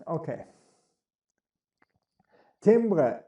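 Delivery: ordinary talk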